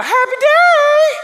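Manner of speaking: squealed Southern "Happy day!", very high and loud; Southern accent. Retold or imitated. Girl greeting staff at dawn, high Southern squeal. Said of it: "she'd go".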